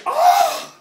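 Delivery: loud, high shout breaking into laughter; laughing shout. Real, not acted. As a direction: yell it while cracking up. Friends react, laughing.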